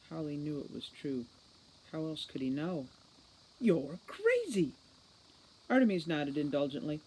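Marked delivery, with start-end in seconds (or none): 3.6-4.7 furious accusation. Acted. Holly accusing.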